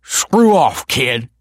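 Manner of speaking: put-on loud, high drunken dismissal; spitting anger. A narrator's reading. Drunk Tony tells Michael to butt out, spitting it. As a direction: spit it out at the kid. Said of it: "spat Tony".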